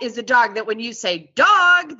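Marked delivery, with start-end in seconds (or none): 1.3-2.0 bright, high and loud cue word; happy voice. Shown as a demonstration. Trainer calling the cue word to the dog, happily and high. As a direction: call the word Dog out cheerfully, much higher. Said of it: "I say dog in a happy voice".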